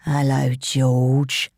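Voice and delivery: low, slow, drawn-out greeting; a brute greeting his victim; menacing edge. A narrator's reading. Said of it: "with a horribly menacing edge to it".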